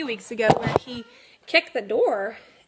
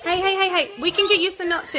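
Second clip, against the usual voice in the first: imitating her son; 7 semitones higher, 5 dB louder, faster than usual. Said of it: "And he goes".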